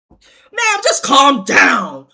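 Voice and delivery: slow, loud mock-patient cop repeat. Cop repeating calm down, slow and loud. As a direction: hammer it home like a cop losing patience. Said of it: "He was like".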